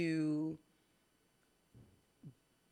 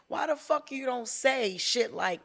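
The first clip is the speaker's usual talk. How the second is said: ordinary talk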